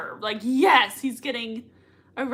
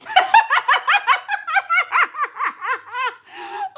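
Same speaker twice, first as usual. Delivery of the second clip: high, loud burst of delighted laughter; real laughter; 5 dB louder than usual. Real, not acted. Fan laughing hard at a favourite scene.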